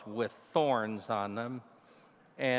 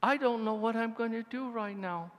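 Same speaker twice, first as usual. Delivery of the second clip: frightened prayer; 9 semitones higher than usual. Retold or imitated. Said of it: "and I said".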